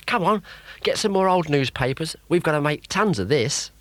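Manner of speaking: put-on high, loud, boastful young man; Rod, boastful and excited. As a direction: crow about becoming a paper king. Rod urging Emu.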